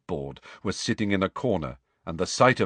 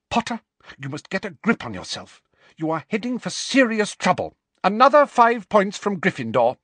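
high, severe reprimand building louder; stern warning; 12 semitones higher, 5 dB louder than usual. Acted. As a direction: tell off a pupil, rising to a loud penalty. Professor warns and punishes pupil.

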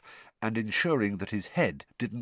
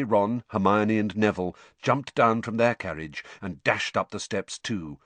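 ordinary delivery

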